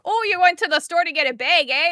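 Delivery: high, teasing Minnesotan lilt; exaggerated sing-song Minnesotan; mocking Minnesotan accent. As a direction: tease with a thick Minnesotan accent. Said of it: "I get more Minnesotan, and then everyone makes fun".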